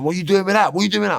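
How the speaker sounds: high, loud repeated demand; older brother catching him, shouting it; angry demand. Retold or imitated. Older brother catches him with gear, loud high demand.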